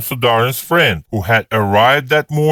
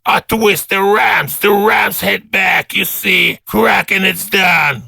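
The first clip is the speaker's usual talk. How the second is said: lightly voiced tipsy bragging, higher and slow; boastful drunk colonel; 8 semitones higher than usual. Colonel announcing his party trick, slow and boastful. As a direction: show off with slow tipsy bravado.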